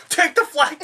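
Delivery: high, loud barked order; mock swat yell. Imagined swat team shouting at the homeowner, mock yell. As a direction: yell it like a cop storming in.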